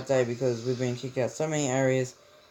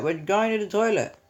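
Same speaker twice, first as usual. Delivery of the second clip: higher, louder call across to the teacher; yelled back; 7 semitones higher, 4 dB louder than usual. Retold or imitated. Students yelling back at the teacher, defiant call. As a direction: shout the excuse back defiantly. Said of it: "we yelled out".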